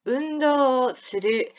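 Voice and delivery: 운동する spelled out very slowly. Shown as a demonstration. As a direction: model 운동する at half speed for students. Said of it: "다시 한번". (아주 천천히 또박또박)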